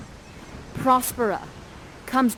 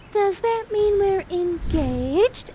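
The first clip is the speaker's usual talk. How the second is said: put-on much higher, slow swooning girl; dreamy slow teen wonder; giddy question; 10 semitones higher, slower than usual. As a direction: draw it out starry-eyed. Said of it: "Miranda's like".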